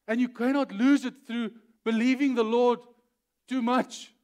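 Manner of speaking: loud, high, emphatic sermon delivery; forceful preaching. Real, not acted. Preacher insisting you must go through the lion, forceful. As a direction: drive each point home at full force.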